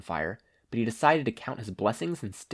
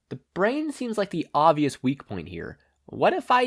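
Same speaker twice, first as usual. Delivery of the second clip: higher, slowing into wonder; curious young hero; 7 semitones higher, 4 dB louder than usual. Acted. Izuku inspecting the exposed brain, higher and curious. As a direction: trail off while testing an idea.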